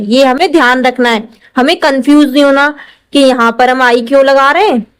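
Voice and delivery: usual speaking voice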